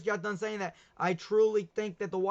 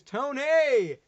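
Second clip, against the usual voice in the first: loud call; 6 semitones higher, 8 dB louder than usual. Real, not acted. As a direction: shout the name high and loud across the room. Calling out to a guest.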